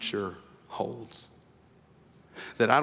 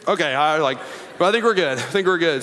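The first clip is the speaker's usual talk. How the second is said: relieved dad; 5 semitones higher, 5 dB louder than usual. Retold or imitated.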